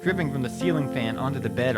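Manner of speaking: plain reading voice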